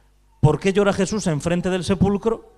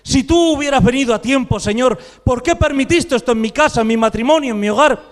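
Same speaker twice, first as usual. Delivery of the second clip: raised, insistent voice of reproach; protesting complaint; 5 semitones higher, 6 dB louder than usual. Acted. Believer demanding answers from the Lord, raised complaining protest. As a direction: cry out an acted complaint to heaven. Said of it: "nos quejamos y decimos".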